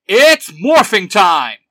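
loud, high, drawn-out rallying cry; slow, booming call to transform; heroic battle call. A narrator's reading. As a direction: shout the team into action. Leader calls the team to transform.

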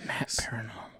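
hushed, whispered name; very soft, voiceless repeat; whispered echo. Real, not acted. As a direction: whisper it back under your breath. Mockingly echoing a disliked name.

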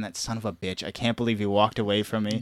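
low, soft imitation of a fuming mom; understated mock cursing; swearing under the breath. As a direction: grumble quietly to yourself, cross. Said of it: "like, swearing".

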